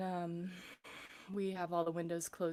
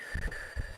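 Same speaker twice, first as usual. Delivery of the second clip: voiceless exhale; long breathy sigh; 3 dB quieter than usual. Real, not acted. Coach pausing mid-lesson to relax, sighing out.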